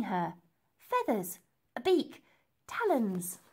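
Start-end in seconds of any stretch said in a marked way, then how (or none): none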